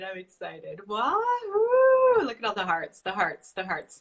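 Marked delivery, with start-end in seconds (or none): 0.9-2.2 high, loud cheer; wahoo. Real, not acted. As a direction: whoop it out loud. Cheering as guest arrives.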